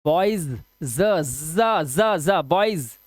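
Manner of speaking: बॉयज with z, then ज buzzed alone repeatedly; voiced z demo on ज and बॉयज; Z sound drill. Shown as a demonstration. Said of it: "इसे बॉयज थोड़ी बोलते हैं".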